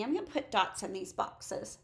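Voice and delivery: ordinary delivery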